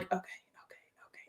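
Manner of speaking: breathless scared whisper; hushed nervous okay, okay. Retold or imitated. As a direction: whisper it, resigned and afraid.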